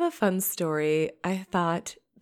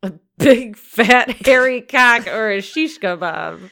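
loud hammed-up reading; loud mock proclamation; 5 semitones higher, 11 dB louder than usual. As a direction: shout it much louder, slightly higher. Repeats definition loudly.

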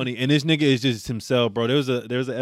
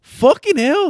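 high, louder exasperation; imitating Shoresy; 7 dB louder, much slower than usual. Shoresy reacting to the cat scene, high and loud. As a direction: groan it out high. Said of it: "He's like".

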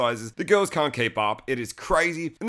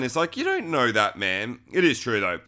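ordinary delivery

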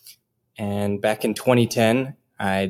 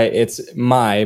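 normal talking voice

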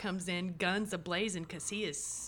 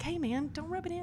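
high mock whine; 10 semitones higher than usual. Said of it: "it's almost like".

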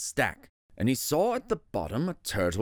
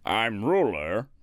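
low, slow, self-important turtle king; lightly voiced lordly turtle ruler; pompous turtle king; 6 semitones lower, slower than usual. A narrator's reading. Yertle the king surveys his little pond, pompous.

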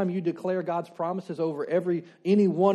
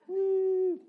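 playful whoop; 14 semitones higher than usual. Pretend. Mimics floating off the ground.

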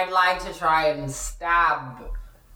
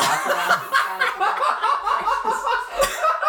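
long, high-pitched cackling; helpless laughter. Real, not acted. Players crack up at Velociraptor suggestion, real high laughter. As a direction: crack up completely, high and sustained.

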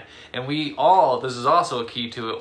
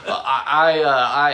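stuttering; 3 semitones higher, 7 dB louder, slower than usual. Retold or imitated. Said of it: "I was, like, stuttering".

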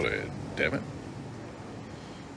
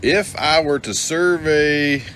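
higher, slow and drawn-out teasing; 7 semitones higher, 4 dB louder, much slower than usual. Acted. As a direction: tease the guest, stretching words. Teasing guest with demands.

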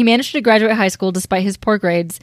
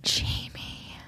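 breathy shocked whisper; 16 dB quieter than usual. Real, not acted. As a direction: gasp the name in a hush. Gasped mock-scandalized name.

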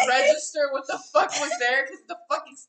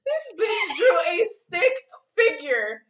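loud, half crying with laughter; laughing shout; 5 semitones higher, 7 dB louder, slower than usual. Real, not acted. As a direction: yell it through tears of laughter. Outraged laughing accusation.

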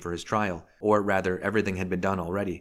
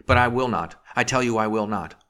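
loud, higher, emphatic refusal; defiant cry; 3 semitones higher, 6 dB louder than usual. A narrator's reading. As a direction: refuse with full force. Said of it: "he cried again".

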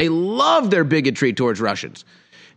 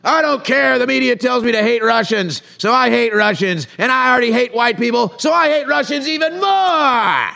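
mocking media-fed bigot; 7 semitones higher than usual. Commentator parodying a leftist who hates Russians, higher mocking voice. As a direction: sneer it like a brainwashed partisan.

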